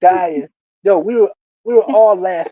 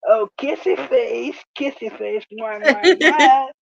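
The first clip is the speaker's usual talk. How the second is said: high mock-lovey coo; teasing kissy call; 6 semitones higher than usual. [name] calls the coworker out before his wife, teasing coo. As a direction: sing out the pet name, then smack big kisses.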